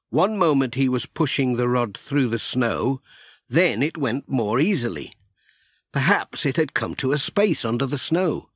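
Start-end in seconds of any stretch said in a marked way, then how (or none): none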